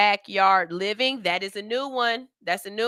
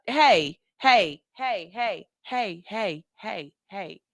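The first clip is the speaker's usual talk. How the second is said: fading hey; much slower than usual. Real, not acted. Calling out to viewers, fading hey. Attention call fading away.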